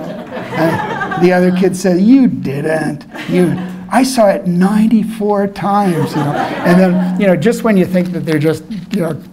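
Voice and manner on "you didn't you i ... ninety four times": louder and higher, laughing as he outdoes his friend; playful child's boast, drawn out slow; one-upping kid. Retold or imitated. Second kid topping his friend's count, loud, high and laughing.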